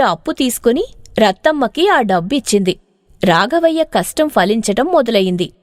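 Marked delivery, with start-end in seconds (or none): none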